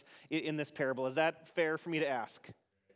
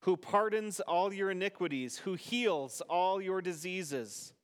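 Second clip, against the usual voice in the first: responsive psalm reading. Acted. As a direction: lead the psalm and leave room for the congregation.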